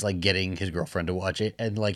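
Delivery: ordinary talk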